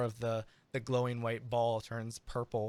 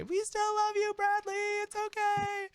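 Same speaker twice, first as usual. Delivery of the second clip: much higher, louder encouraging call; cheering dancers; 3 dB louder than usual. Acted. Dancers calling out to Bradley after his missed note, encouraging. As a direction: call out loving support from the dance floor. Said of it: "on the dance floor say".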